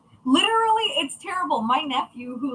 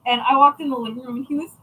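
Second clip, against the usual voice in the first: usual speaking voice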